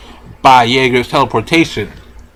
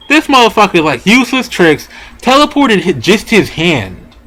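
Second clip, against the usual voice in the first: excited; 6 semitones higher than usual. Real, not acted. Hyped retelling of the twist.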